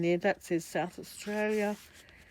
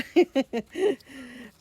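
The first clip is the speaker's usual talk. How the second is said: short bright burst of real laughter; 6 semitones higher, 7 dB louder than usual. Real, not acted. Laughing at herself while showing a feather. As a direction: laugh it off lightly.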